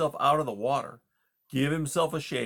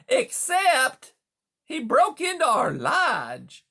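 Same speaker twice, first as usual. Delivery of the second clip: loud, high smooth-talking hustler; a slick wise-guy beaver selling hard; fast-talking beaver; 14 semitones higher, 7 dB louder, slower than usual. A narrator's reading. Beaver Conrad speaks.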